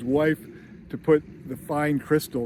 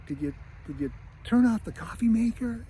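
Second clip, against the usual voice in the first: higher fretting mom voice; worried mom; 8 semitones higher than usual. Retold or imitated. Mom fretting to dad on the plane, higher voice. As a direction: fuss like an anxious mother. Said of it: "It's like".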